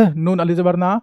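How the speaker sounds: ordinary talk, nothing marked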